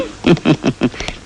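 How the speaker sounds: low, soft chuckle; short laugh under the breath. Real, not acted. Father scolding his son in bed, chuckling.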